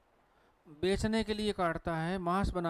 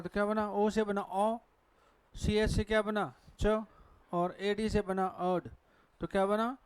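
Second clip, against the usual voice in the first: normal talking voice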